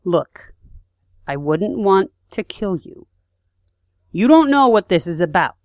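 a veiled threat that rises to a warning; acting as Dash. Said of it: "I'm Dash".

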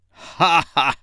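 hearty scornful laugh; mock laugh. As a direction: laugh at the captives. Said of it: "cried the king".

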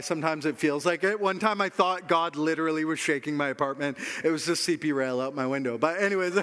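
usual speaking voice

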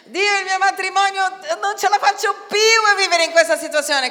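high, loud, pleading cry; a desperate spouse's loud plea; desperate prayer. Pretend. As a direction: cry out that you can't go on. Despairing spouse praying.

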